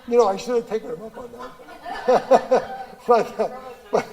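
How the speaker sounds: laughing through the words, breaking up. Real, not acted. Amused speaker laughing at his own story, laughing. Joke about the dildo remark.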